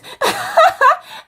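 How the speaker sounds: high giggling laugh at her own joke; a real laugh breaking out; high burst of laughter. Real, not acted. Laughing at her own Game of Thrones joke.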